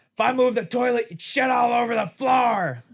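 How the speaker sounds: loud, high-pitched holler, stretched at the end; mock coach yell. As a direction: bellow it like a crazy football coach. Imitating the crazy coach yelling.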